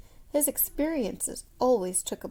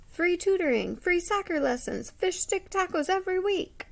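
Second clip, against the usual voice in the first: boastful pitch; 8 semitones higher, 5 dB louder than usual. Acted. Thomas's campaign promises listed.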